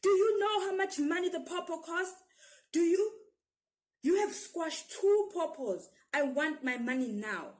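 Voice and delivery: loud, high furious scolding; angry shout. A narrator's reading. Stall woman scolding the boys over squashed pawpaws, shouting high. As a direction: tear into the little boy. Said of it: "leans over and shouts".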